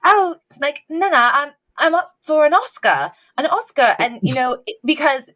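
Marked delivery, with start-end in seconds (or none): 0.0-4.0 a grown man fishing for praise; hammed-up high eager son; eager Elton. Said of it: "he was literally like".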